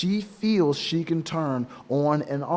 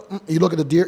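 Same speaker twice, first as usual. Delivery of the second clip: usual speaking voice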